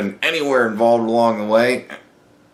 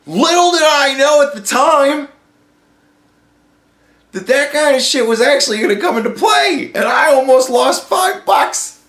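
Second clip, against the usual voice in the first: much higher and louder, half shouting; worked-up rant; 5 dB louder than usual. Real, not acted. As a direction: get wound up and shout it out, slowing down at the end. Ranting about the nearly lost bet.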